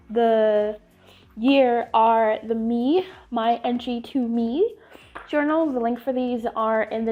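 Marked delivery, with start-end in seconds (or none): none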